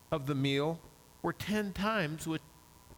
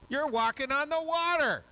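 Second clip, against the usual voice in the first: much higher and louder, thrilled; amazed; 9 semitones higher, 5 dB louder than usual. Acted. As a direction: let the wonder burst out. Climax of walking on water.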